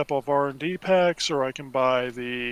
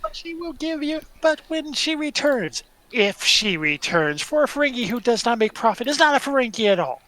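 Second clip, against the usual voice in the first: high, hammed-up Ferengi with stretched-out words; 9 semitones higher, 5 dB louder than usual. Ferengi telling a caller about her return, high put-on voice. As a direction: play a Ferengi answering machine, high and emphatic. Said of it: "I'll get my Ferengi voice on".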